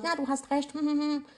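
high, soft voice of giving up; acted meek agreement trailing into hums. Acts out giving in to doubters.

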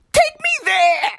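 high yelling plea to be taken away; desperate high shout. Acted. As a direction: belt it out like begging the sky. Ranting to be shipped off planet.

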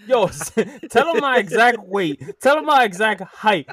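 loud, high, exasperated rant; indignant sarcasm. Real, not acted. Mock outrage at shared personal details.

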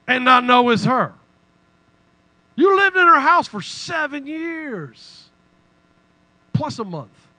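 you can't believe anyone missed this; incredulous. Real, not acted. Preacher's incredulous rhetorical questions.

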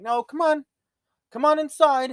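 coaxing owner calling his husky in; calling the dog. Retold or imitated. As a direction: call him up the stairs.

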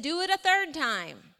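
big storyteller's command, high and loud; emphatic command. Acted. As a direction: call out the order, stretching it for emphasis. Elijah orders water poured yet again.

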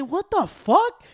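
high, drawn-out incredulous yelp; acted disbelief. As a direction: stretch it out high in mock outrage. Acts out shock at rejected card.

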